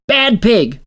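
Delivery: high, loud scolding of a pet; scolding shout. Acted. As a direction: shout down the pig. Scolding pig.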